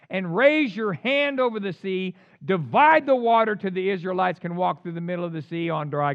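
loud, measured command; forceful scriptural instruction to act; God commanding. Acted. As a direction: proclaim the order with force. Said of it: "Then the Lord said to Moses".

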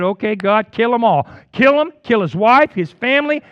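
loud, high, furious demand to God; furious prayer. Retold or imitated. Preacher retelling his prayer for a church rival, loud and furious. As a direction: spit it out like a bitter prayer. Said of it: "I sat down and I said".

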